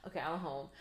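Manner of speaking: low, soft contentment; relieved sigh of home. Retold or imitated. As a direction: settle in like you just got home. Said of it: "and I'm like".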